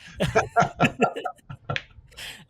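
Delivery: high, airy burst of laughter; real amused laughing fit. Real, not acted. Both amused at the resting joke.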